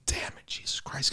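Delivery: exasperated whisper to himself; frustrated muttering. Retold or imitated. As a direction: curse under your breath, barely voiced. Retelling his muttered frustration in line.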